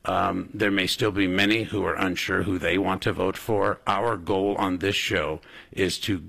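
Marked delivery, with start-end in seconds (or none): none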